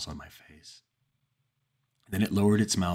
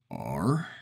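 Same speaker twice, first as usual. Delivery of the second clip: croaked; 4 semitones lower than usual. A narrator's reading.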